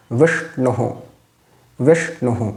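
"বিষ্ণুহু" ending in a short echoed hu; visarga as hu. Shown as a demonstration. Teacher shows visarga after short u, demonstrating pronunciation. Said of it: "বিসর্জনীয় উচ্চারণটাও হু হচ্ছে".

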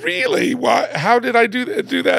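high, strained voice about to cry; voice breaking. Real, not acted. Guest retelling his own reply to his teacher, voice breaking. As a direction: hold back the tears and barely get it out. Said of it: "I'm trying so hard not to cry".

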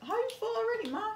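rushed, high disbelief; fast incredulous. Real, not acted. Teasing mom at dinner.

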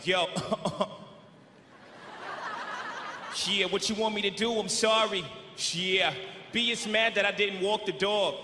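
low rapper voice with lazy pauses. Acted. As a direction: imitate a laid-back, apologizing rapper. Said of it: "He was like".

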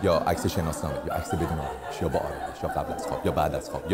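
low, hushed mock-serious list; understated ironic murmur; deadpan listing. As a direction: drop low and soft, reciting each absurd option. Deadpan list of trivial photo challenges.

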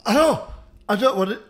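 loud, high, retching protest; disgusted cry. Real, not acted. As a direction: recoil and cry out. Retching at the tripe.

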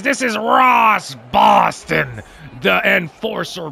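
slow, drawn-out hype announcer voice; announcer intro. Acted. Gamer introducing his player to viewers, hyped announcer boom. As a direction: boom out the name like a ring announcer. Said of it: "I'll tell you what this is".

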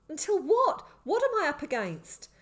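high, loud, panicked questions; alarmed questioning. A narrator's reading. Boy reacts to the dire warning, alarmed. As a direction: demand to know in alarm.

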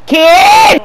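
sudden high yell of the name; shout. Real, not acted. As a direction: bellow the striker's name at the screen. Gamer yelling at striker on screen.

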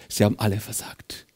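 whispered, hushed taunt; Satan whispering to Jesus. Acted. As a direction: breathe it out as a secret.

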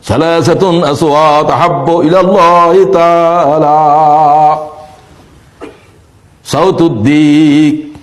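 sing-song sermon chant with drawn-out line endings; melodic waz preaching. Real, not acted. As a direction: chant it to a congregation, stretching final vowels. Recites and chants. (সুরেলা ওয়াজ, শেষ শব্দে টান)

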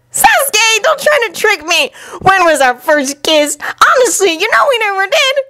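put-on high-pitched indignant boy; high, embarrassed denial; flustered protest. A narrator's reading. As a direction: protest hotly in a high youngster's voice. Naruto protests about the kiss.

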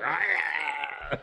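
drawn-out monster cry; hideous creature noise. Acted. Veil pulled off hideous creature, grotesque cry. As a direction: imitate a grotesque cartoon creature. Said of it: "the most hideous creature ever".

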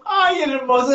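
high laughing cry; laughing fondly. Real, not acted. Host still laughing, praising her guest. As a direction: swoon through the laughter.

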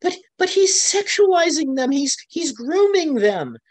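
mock-weeping protester insisting on grooming claim; retold tearful insistence. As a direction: imitate her sobbing outrage, pitched well up. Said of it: "And she's crying".

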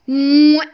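loud smacking kiss; kiss sound. Pretend. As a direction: blow a big kiss.

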